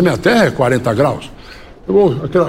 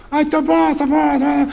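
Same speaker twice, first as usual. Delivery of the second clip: higher, chirpy quero-quero answering; a bird chattering back, higher; lapwing's voice; 10 semitones higher than usual. Acted. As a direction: priest throws his voice as the passing bird, higher. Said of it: "E imitou".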